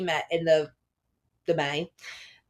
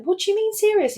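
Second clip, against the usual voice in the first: much higher, startled; incredulous question; 13 semitones higher than usual. Retold or imitated. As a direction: ask it in disbelief. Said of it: "I was just a bit like".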